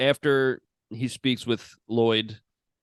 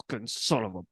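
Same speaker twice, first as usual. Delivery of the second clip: 1 mild mock anger; 2 semitones lower, 5 dB quieter than usual. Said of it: "He's so angry".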